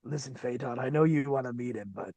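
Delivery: a quiet, patient official taking someone aside; hushed mock mayor. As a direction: drop to a low, hushed voice, like a mayor quietly reasoning with a colleague. Mock mayor talking Phaeton down.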